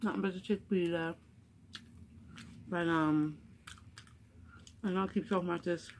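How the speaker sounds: speaking between bites; talking while chewing. Real, not acted. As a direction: mouth partly full. Seen 3: hand brings food to mouth, lips look chewing during words.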